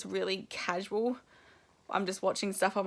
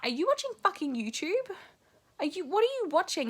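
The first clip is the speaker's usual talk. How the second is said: higher, outraged disbelief; husband hears a disbelieving, higher-pitched challenge; incredulous accusation; 10 semitones higher than usual. Retold or imitated. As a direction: call him out, half laughing at the cheek. Accusing the doctor behind them.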